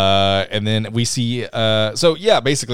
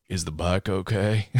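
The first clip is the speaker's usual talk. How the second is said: understated hero imitation; 3 semitones lower, 6 dB quieter than usual. Hero's reply after the crash.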